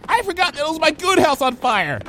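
high-pitched frantic cry; frantic, high character voice; panicked. Acted. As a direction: blurt it out in a panic. Panicked character racing past cashier.

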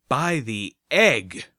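loud, drawn-out exclamation; swearing oath. A narrator's reading. Blackwing curses before promising to find a plan, swearing loudly. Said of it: "Blackwing swore".

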